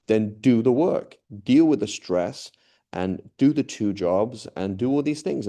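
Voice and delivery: stern wife telling her husband to man up, loud and slow; firm lecturing wife. Retold or imitated. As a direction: lay down the law slowly and loudly.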